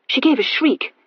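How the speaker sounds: plain reading voice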